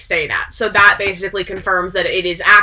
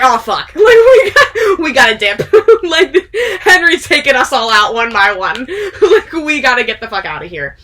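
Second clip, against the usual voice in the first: laughing panic; 4 semitones higher, 5 dB louder than usual. Retold or imitated. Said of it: "So they're all like".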